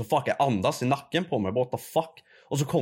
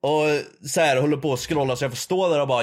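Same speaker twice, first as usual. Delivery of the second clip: ordinary talk, nothing marked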